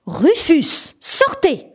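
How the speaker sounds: teacher orders Rufus out, shouting. A narrator's reading. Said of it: "a crié la maîtresse".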